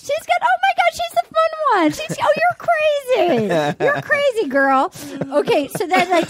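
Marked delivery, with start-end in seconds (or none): 0.0-4.9 put-on much higher, fast gushing girl; high girly voice. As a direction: gush like a bubbly teen girl. Imitating high school girls.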